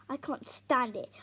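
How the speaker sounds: ordinary delivery, nothing marked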